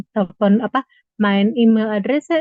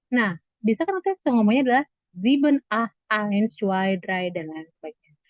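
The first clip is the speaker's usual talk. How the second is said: ordinary talk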